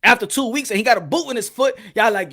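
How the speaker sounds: ordinary delivery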